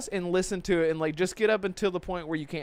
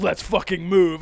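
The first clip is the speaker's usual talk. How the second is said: mock action hero line; 7 dB louder than usual. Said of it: "he's like".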